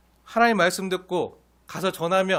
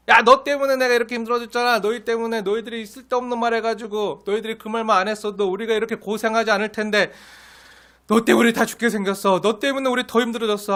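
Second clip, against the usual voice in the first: louder, higher griping at Moses; grumbling blame; 4 semitones higher, 4 dB louder than usual. Retold or imitated. Israelites cursing Moses and Aaron, a higher mock complaint. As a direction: whine angrily as if blaming Moses for everything. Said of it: "모세와 아론에게도 저주하면서".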